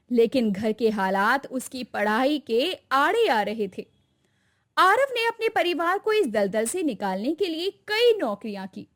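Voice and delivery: plain reading voice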